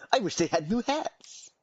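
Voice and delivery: high squeaky silly voice; silly clown voice. Pretend. Reading chat in funny voice.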